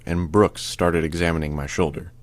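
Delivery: usual speaking voice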